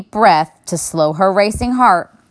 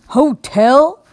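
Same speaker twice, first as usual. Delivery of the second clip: put-on astonished old bear; 8 semitones higher, 4 dB louder than usual. A narrator's reading. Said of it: "in astonishment".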